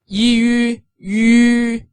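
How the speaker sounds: yi then yu, held high and level; slow sound-out of yi yu; teaching blend of yi into yu. Shown as a demonstration. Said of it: "U in this case pronounce yu".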